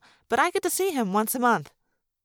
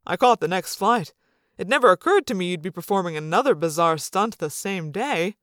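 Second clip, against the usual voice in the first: hint of an easygoing father's voice; lightly voiced dad; 3 semitones lower than usual. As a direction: lightly play the father talking to his child.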